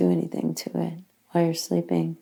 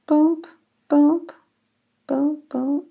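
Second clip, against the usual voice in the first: imitated knocks; 10 semitones higher, slower than usual.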